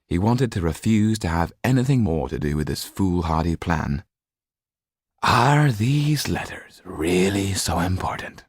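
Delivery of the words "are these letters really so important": usual speaking voice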